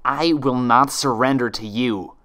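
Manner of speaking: loud, slow defiant shout; shouting back. Acted. Twilight defying the monster, shouting. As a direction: hurl it back at her. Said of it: "she shouted back".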